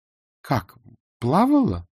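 high-pitched, frowning query; frowning question. A narrator's reading. Anna questions Vronsky about the swimming teacher, frowning. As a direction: ask it with a displeased frown, voice lifted. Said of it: "хмурясь спросила Анна".

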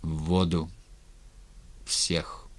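low voice sinking to a whisper; sunken voice. A narrator's reading. Rebel leader sentencing captives to the water, sunken voice. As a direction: drop it low, the last word nearly voiceless. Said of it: "Сказал осевшим голосом".

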